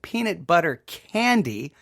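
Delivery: normal talking voice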